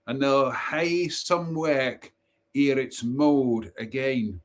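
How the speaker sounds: put-on high, slow Scottish brownie voice with a heavy Scots accent. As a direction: play the departing brownie in broad Scots, pitched high and drawn out. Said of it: "probably the best Scottish accent you'll hear".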